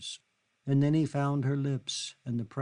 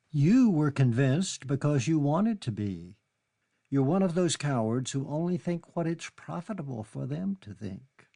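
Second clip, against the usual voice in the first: angry accusation; 5 semitones higher, 5 dB louder than usual. Acted. Kitty angrily accusing Charlie.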